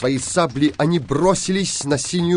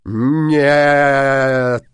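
loud, drawn-out no; loud refusal; 6 dB louder than usual. A narrator's reading. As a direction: burst out with a long, loud refusal. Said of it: "отвечал Синяя Борода". (громкий протяжный отказ)

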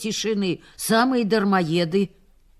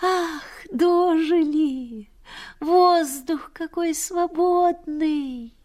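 higher, slow, marvelling; a rapturous slow sigh of joy; delighted exclaiming; 8 semitones higher, much slower than usual. Retold or imitated. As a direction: gasp in delight at the spring air. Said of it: "всё-то ахало".

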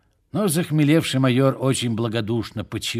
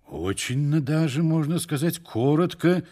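higher-pitched, slightly affronted reply; piqued self-importance; 6 semitones higher than usual. A narrator's reading. Иван Иванович is stung by over-familiar address, piqued self-importance. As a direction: answer with injured dignity, pitched up. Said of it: "амбициозно заметил Иван Иванович, несколько задетый".

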